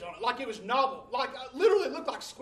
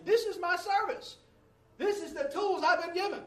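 raised pitch; 9 semitones higher than usual. Real, not acted. Dramatic point in sermon story.

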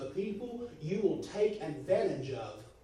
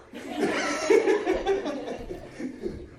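a breathy burst of real laughter; airy laugh; 12 semitones higher, 8 dB louder than usual. Real, not acted. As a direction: crack up at your own joke. Laughs at his own Malachi joke.

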